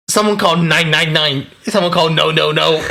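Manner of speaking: lightly acted panicky call; mock panicked caller. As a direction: play a flustered tourist calling for help. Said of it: "you go like".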